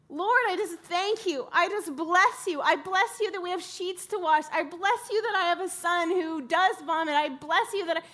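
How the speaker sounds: loud, high, insistent thanks to God; forced out-loud prayer. Retold or imitated. Mother praying aloud to the Lord over sick son, loud and insistent. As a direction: pray it out forcefully, blessing on blessing. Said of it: "Like, I had to be".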